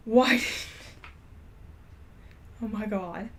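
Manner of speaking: ordinary delivery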